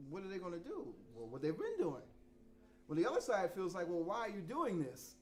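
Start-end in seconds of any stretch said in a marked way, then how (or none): none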